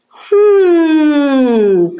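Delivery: long, high hum sliding down; loud, drawn-out feline hmm; purring cat. A narrator's reading. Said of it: "purred the cat".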